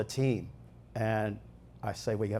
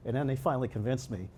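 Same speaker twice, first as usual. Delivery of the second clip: ordinary delivery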